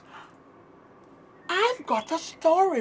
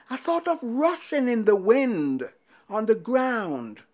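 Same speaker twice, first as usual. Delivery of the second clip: ordinary delivery, nothing marked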